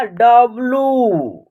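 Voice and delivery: "डब्लू" very slow, last vowel held long; pronunciation demo. Shown as a demonstration. Teacher answering how it is pronounced, "डब्लू" drawn out. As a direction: stretch out "डब्लू", falling at the end. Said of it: "इसका उच्चारण क्या होगा?".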